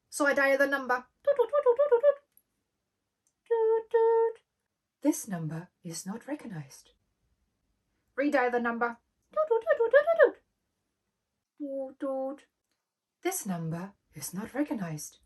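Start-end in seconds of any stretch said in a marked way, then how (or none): 1.2-4.4 high, loud toot toot; phone ringing tone. Retold or imitated. 5.0-6.9 slow, lower recorded-voice reading; automated phone message. Retold or imitated. 9.3-12.5 high, loud toot toot; phone ringing tone. Retold or imitated. 13.2-15.2 slow, lower recorded-voice reading; automated phone message. Retold or imitated.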